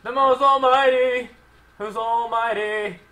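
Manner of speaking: high, slow, drawn-out boastful holler; triumphant crowing. Pretend. Player bragging over a win, triumphant crowing. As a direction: crow it grandly, stretching each word.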